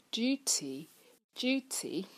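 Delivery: "duty" with a yoo glide, dyoo-ty; British long u. Shown as a demonstration. Teacher demonstrates British U in a second word, "duty" as dyoo-ty. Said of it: "这个U在英音里面是偏向于U".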